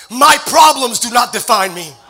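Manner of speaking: loud, slow, deliberate creed; a congregation told to repeat, declaring each phrase; declaration with conviction. Shown as a demonstration. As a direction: proclaim every line slowly and loud. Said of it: "say it with every fiber of your being".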